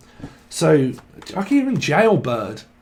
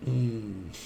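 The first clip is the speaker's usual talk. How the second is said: usual speaking voice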